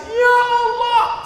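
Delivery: high, long-drawn imitated wail; mock weeping. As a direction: imitate pilgrims wailing to God. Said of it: "satu rombongan nangis". (menirukan ratap tangis jamaah)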